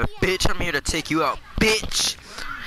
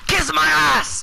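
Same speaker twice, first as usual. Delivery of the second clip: high, loud angry taunt; defiant yell; 10 semitones higher, 6 dB louder than usual. Real, not acted. Gamer to a finished opponent, defiant yell. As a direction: yell it in their face.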